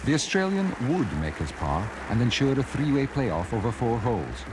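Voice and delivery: ordinary talk, nothing marked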